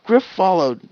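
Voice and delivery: plain reading voice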